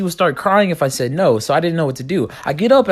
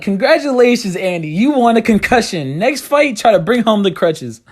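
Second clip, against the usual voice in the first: sarcastic congratulations; 6 semitones higher than usual. Retold or imitated. Sarcastic reply to friend.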